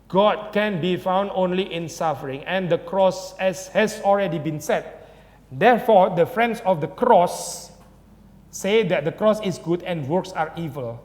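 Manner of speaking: slow, solemn reading of scripture-like text; slow quotation reading. Acted. Preacher reading Luther's thesis aloud to the church, slow and solemn. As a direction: lay out each clause slowly like a proclamation.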